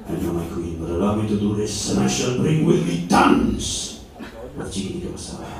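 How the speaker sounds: low, hushed wheedling plea; sheepish Columbus. Acted. Columbus bargaining with queen, low coaxing voice. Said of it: "Columbus replies to queen".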